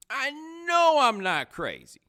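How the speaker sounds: very high, loud, stretched KNOW; exasperated insistence. Retold or imitated. Sure the friend never talked like this, exasperated insistence. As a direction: hold KNOW long and high, then let it drop. Repeats the protest, drawn out.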